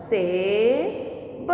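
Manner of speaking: सेब drawn out very slowly; teacher slowly saying the blended word सेब; slow demo word. Shown as a demonstration. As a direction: stretch सेब for the class to copy. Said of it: "आया समझ में बच्चों".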